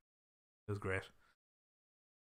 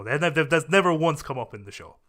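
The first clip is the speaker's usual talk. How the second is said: normal talking voice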